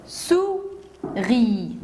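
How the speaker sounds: "souris" as two slow separate beats; syllable by syllable. Shown as a demonstration. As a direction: count out "souris" as sou... ri. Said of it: "Deux syllabes".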